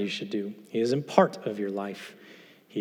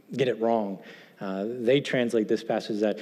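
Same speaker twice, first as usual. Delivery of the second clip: ordinary talk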